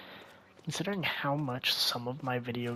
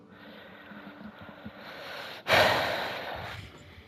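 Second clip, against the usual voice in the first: long frustrated sigh. Real, not acted. Frustrated after long search.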